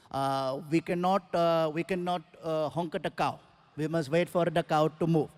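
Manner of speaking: put-on hesitant Indian-accented driver explaining to his passenger. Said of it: "I asked the driver".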